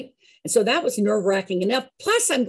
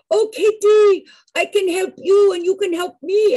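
put-on high, loud, bright French-accented offer; a lilting French accent, pitched up and loud; 9 semitones higher, 6 dB louder than usual. Madeleine offering Katie a deal on the plane, French accent. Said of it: "she had the most lovely French accent".

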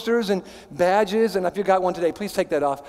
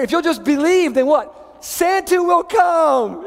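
higher, louder, slowing to a cry of Santa will come; mock movie believer; 6 semitones higher, 3 dB louder than usual. Pastor mocking a Christmas film line, higher and drawn out. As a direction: ham up the Christmas movie promise. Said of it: "Will Ferrell wants us to hear".

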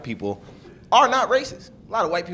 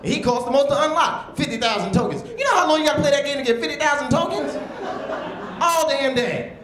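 exasperated; 11 semitones higher than usual. Real, not acted. Comic to the crowd about the game, high exasperated outburst. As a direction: throw up your hands at the grind.

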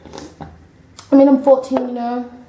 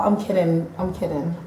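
ordinary delivery

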